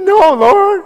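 preacher balking at God's order to give away his cash; comic anguished protest. Retold or imitated. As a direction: cry it out like a pained whine. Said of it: "I said".

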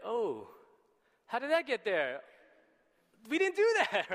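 high, loud mock-panicked protest; shocked denial. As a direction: play caught red-handed, squealing innocence. Brothers find cup in bags.

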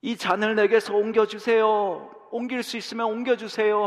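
an anguished son crying out to his father; anguished plea. Retold or imitated. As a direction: plead for the cup to pass. Jesus' prayer voiced in sermon.

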